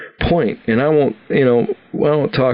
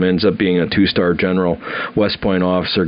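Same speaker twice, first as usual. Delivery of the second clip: ordinary talk, nothing marked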